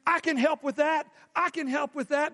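bright overeager "I can help" voice; lightly voiced eager volunteer; eager promise. Preacher mimics unreliable helper.

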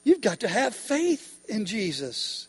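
ordinary delivery

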